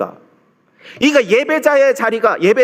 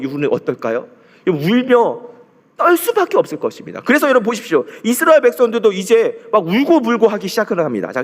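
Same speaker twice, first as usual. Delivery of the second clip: ordinary delivery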